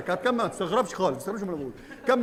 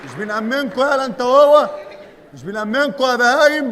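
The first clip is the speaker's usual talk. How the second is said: angry; 2 semitones higher, 7 dB louder than usual. Acted. Cop scolding the two.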